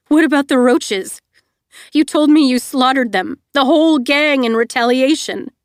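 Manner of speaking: upset. A narrator's reading. Confronting Ace, upset.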